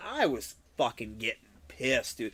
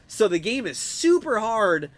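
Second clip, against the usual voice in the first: ordinary delivery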